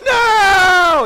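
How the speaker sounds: high, loud crying "No!"; daughter's wail. Retold or imitated. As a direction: shriek it like a child in tears. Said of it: "daughter protests".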